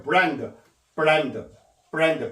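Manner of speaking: 브랜드 with an R, tongue clear of the palate; R instead of L. Shown as a demonstration. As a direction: model "브랜드" as brand, no tongue contact on the R. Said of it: "이제 요게 R이 되면요".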